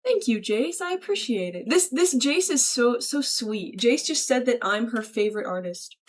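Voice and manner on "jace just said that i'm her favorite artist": ordinary talk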